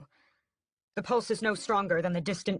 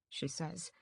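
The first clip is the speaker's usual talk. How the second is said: ordinary delivery, nothing marked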